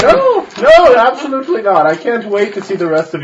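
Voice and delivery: very high, loud fussy gent; pompous nobleman. Acted. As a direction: squeal the reassurance like a fop. Noble reassures showman in character.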